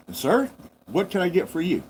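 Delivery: lifted, sweet service voice; polite waitress. Retold or imitated. Waitress politely asking the customer's order. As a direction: greet the customer like a cheerful waitress.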